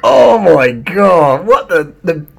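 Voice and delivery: drawn-out, loud and high oh my God; slow and loud in open disbelief; appalled gasp of a reaction; stunned disbelief. Real, not acted. Shocked reaction to rider quote.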